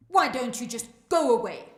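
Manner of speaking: loud, fast snap; angry dismissal. Acted. Naledi tells the fairy to leave, angry and loud. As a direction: tell them off loudly and quickly.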